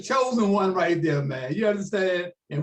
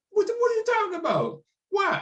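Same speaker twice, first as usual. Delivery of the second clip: much higher, incredulous imitation; mock disbelief; 12 semitones higher than usual. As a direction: sound floored by the refusal. Retold Ali stunned by refusal.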